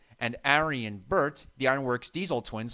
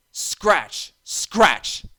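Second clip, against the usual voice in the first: scratching noise; 5 semitones higher, 8 dB louder than usual. A narrator's reading. Strange noise again.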